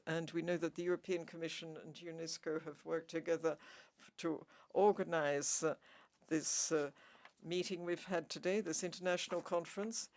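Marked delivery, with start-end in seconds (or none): none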